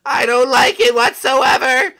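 nervous whine. Acted. Scared of sea travel.

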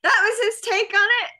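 high, loud, stunned question; can't believe what she's hearing; shocked disbelief. Real, not acted. Friend reacts to the priest's remark with loud high disbelief.

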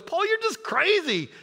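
hammed-up, high-pitched incredulous outburst; playful high exclaiming, breaking up midway; Festus. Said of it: "I love what Festus goes".

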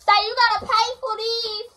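insistent, high carrying voice; calling out. Real, not acted. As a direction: keep hollering it across the house. Keeps calling out to someone.

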